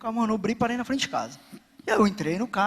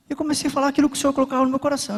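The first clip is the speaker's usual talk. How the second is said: usual speaking voice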